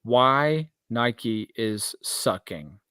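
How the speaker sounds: slow, deliberate title announcement; title read. Shown as a demonstration. Proposing the episode title to cohost, slow and deliberate. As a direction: spell out the episode title, slowly. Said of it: "this episode should be titled".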